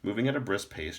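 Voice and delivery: usual speaking voice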